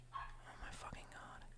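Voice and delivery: breathless whispered curse, slow; exasperated whisper. Real, not acted. Dog won't stop barking.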